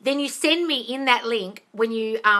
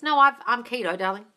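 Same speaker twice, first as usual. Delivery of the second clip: normal talking voice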